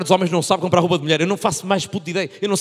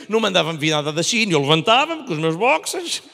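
ordinary talk

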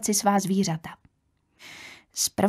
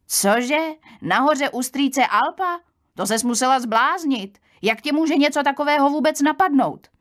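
incredulous, climbing higher; shocked disbelief; 6 semitones higher, 3 dB louder than usual. Acted. Young woman reacting to the aunt's plan, shocked and disbelieving. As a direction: scold her in astonishment, voice lifting.

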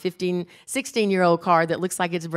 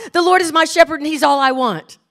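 loud, high child's recital, imitated; determined final try; 6 semitones higher, 8 dB louder than usual. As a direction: give it everything, like a little girl onstage. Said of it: "gave it one final try".

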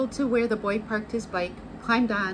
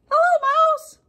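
cheery duck voice; 10 dB louder than usual. A narrator's reading. Duck calling out to Cow as he rides past, cheery duck voice. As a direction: call out high and loud as a little duck. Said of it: "said Duck".

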